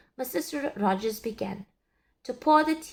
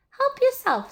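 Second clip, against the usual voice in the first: high hostess voice; 7 dB louder than usual. A narrator's reading.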